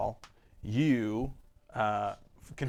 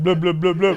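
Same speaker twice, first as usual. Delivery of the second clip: loud, higher mock blubbing; mocking blub impersonation; 7 semitones higher, 14 dB louder than usual. Game master mocking the players' sounds, loud high blubs. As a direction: blub it out loudly, poking fun. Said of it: "Here's my impersonation".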